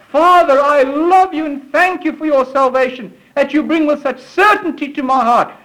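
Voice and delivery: high, ardent address to God; fervent prayer. Acted. Believer thanks his Father for salvation, fervent prayer. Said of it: "in all of his weakness and his".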